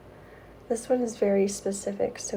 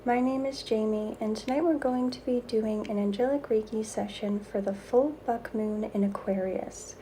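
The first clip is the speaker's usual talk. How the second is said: airy breathy mystical healer; 4 dB quieter than usual. Acted.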